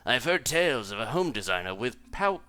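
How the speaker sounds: fancy cat character voice. Acted. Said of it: "gotta do some live voices here".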